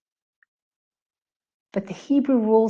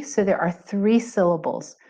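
ordinary talk

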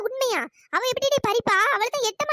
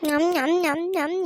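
smacking nyam nyam chewing sounds, lower; mock chewing noises; 5 semitones lower, much slower than usual. Eating guavas greedily.

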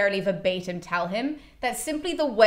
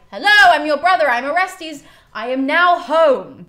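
higher, much louder, slowing at the end; grand announcement; 6 semitones higher, 9 dB louder than usual. Retold or imitated. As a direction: proclaim it like a hero's return. Orestes announcing himself grandly.

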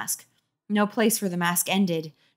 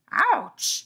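pained yelp; 11 semitones higher than usual. Acted. Mask pulling pain, high.

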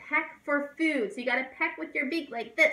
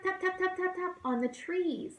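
higher, tapping rhythm; woodpecker tapping; 7 semitones higher than usual. Shown as a demonstration. Teacher taps like a woodpecker for kids. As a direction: tap it out like a beak. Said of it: "heard them tap".

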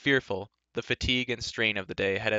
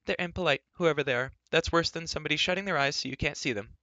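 higher-pitched, huffy voice; miffed character voice, pitched up; Elenwy, affronted; 6 semitones higher than usual. A narrator's reading. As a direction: complain indignantly in a higher voice.

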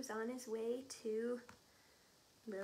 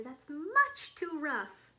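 loud, high, drawn-out scolding; crying out; 5 semitones higher, 11 dB louder, slower than usual. A narrator's reading. Mother tells Lily it's too rough, crying out slowly. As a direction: scold loudly, stretching the words. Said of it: "cried her mother".

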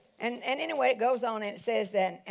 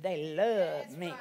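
drawn-out assurance; 8 semitones lower, 3 dB quieter, much slower than usual. Retold or imitated. Preacher answering the devil's lie, slow low assurance. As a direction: stretch out "love" with settled confidence.

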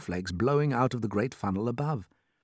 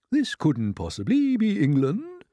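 put-on slow, high old grasshopper voice; old green grasshopper; 8 semitones higher than usual. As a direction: draw it out in disbelief as an old insect.

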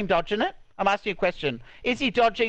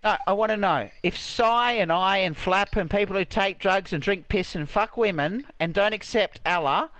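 normal talking voice

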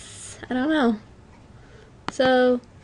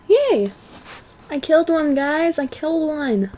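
high, gleeful celebration; excited cheer; 5 semitones higher than usual. Real, not acted. Gamer telling viewers about a kill, excited and high.

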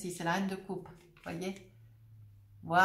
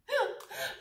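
real high laugh; 13 semitones higher than usual. Real, not acted. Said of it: "je ris parce que c'est".

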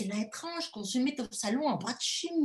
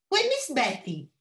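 governess voice; 4 semitones higher, 5 dB louder than usual. Acted. Governess answers madame.

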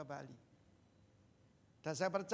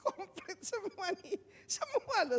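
high laughing delight; 12 semitones higher, 4 dB louder, slower than usual. Real, not acted. Preacher laughing to congregation about gifts from in-laws. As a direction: laugh through it, amazed.

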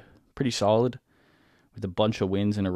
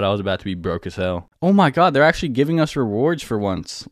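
ordinary talk

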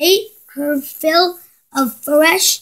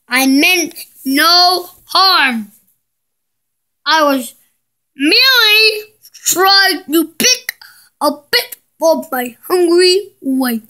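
ordinary delivery, nothing marked

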